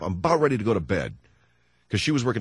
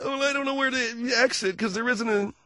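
wife's voice, high; 14 semitones higher than usual. Retold or imitated. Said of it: "wife voice".

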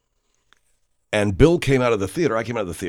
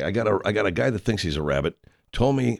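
ordinary talk, nothing marked

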